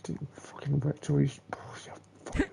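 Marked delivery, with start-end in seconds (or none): 1.5-2.5 soft whispered curse; muttered swearing at the game; cursing whisper. Real, not acted. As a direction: curse it under your breath. Cursing the game under breath.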